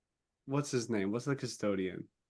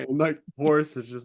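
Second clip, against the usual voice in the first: usual speaking voice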